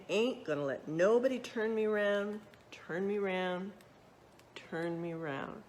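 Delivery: plain reading voice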